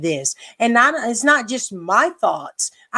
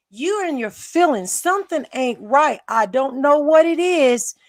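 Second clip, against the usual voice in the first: usual speaking voice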